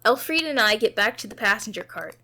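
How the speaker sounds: ordinary delivery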